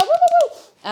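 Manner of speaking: very high, loud woo-woo cheer; cheering whoops. Real, not acted. Streamer cheering the returning chat bot, high loud whoops. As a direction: whoop like a party crowd.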